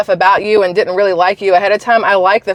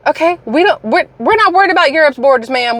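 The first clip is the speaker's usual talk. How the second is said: high, exasperated talking-to; exasperated scolding; 6 semitones higher than usual. Real, not acted. Scolding a politician directly.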